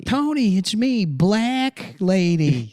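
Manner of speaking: very high, loud, drawn-out falsetto; mock lady voice. Comic playing a lady calling to Tony, high mock cry. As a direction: announce it in a hammed-up squeal. Riffing in a put-on character voice.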